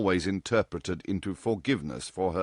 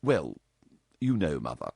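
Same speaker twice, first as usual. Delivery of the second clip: ordinary delivery, nothing marked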